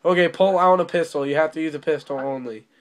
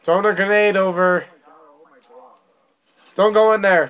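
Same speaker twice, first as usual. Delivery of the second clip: grenade warning yell; 3 semitones higher, 5 dB louder than usual. Real, not acted. Warning teammates of thrown grenade.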